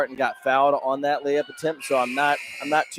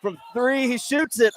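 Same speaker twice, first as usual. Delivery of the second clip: high, stretched, hopeful call; excited shot call; 10 semitones higher, slower than usual. Real, not acted. Co-announcer follows a three-point shot in the air, excited shot call. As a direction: hang on the shot as it flies.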